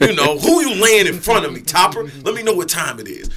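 high, loud battle swagger; loud, boastful trash talk; cocky challenge. Retold or imitated. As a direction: demand your next opponent. Retold cocky challenge before battle.

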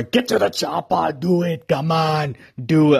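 a hint of Arnold's shouty action-hero bark; half-hearted, badly faked action-hero impression; Arnold Schwarzenegger. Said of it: "the worst Arnold Schwarzenegger impression".

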